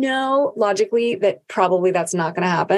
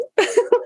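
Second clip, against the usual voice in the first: high laugh. Real, not acted. Laugh after quote.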